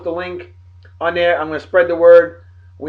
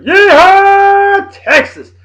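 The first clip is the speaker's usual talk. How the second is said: much higher, louder and slower on the joke town name; playful Yeehaw; 10 dB louder, much slower than usual. Real, not acted. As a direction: ham up "Yeehaw" like a rodeo call. Jokey Yeehaw Texas shoutout.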